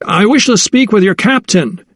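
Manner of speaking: calling up high to a sailor on deck; shouted. Acted. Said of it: "he shouted".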